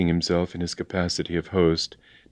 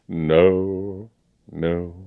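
slow-fading, subdued denial; gentle refusal. A narrator's reading. Colonel gently refuses, against James.

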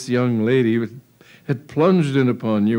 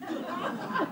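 squeaky chuckle; a quick high laugh; high giggle; 12 dB quieter than usual. Real, not acted. Narrator laughs.

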